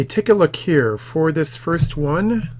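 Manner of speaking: usual speaking voice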